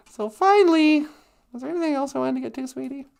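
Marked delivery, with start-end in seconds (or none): none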